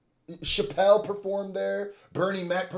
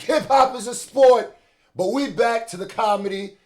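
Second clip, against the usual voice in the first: hype shout; 6 semitones higher, 7 dB louder than usual. Real, not acted. Host hyping up the show.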